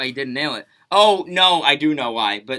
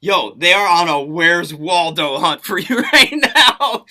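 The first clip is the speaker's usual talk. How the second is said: laughing report; 8 dB louder, much slower than usual. Retold or imitated. Friend reports back, amused.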